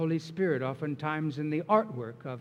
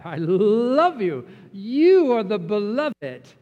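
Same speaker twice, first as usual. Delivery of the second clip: slow, much louder, a booming loving declaration; fervent affirmation; 4 semitones higher, 9 dB louder, slower than usual. Acted. The Father declaring love to his Son, slow and loud. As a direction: proclaim the Father's love slowly.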